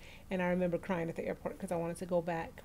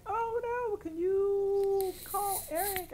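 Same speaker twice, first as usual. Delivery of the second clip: high, drawn-out mock dismay; stretched-out "oh no" plea, pitched way up; retold panic; 13 semitones higher, much slower than usual. Host retells the phone call, high mock panic. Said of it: "And I was like".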